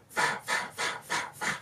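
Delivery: voiceless, rapid puffed hits; imitated blow sounds. Narrator acting out pistol whipping, voiceless hit noises. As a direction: mimic repeated strikes with breath alone.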